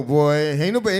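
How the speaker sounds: ordinary delivery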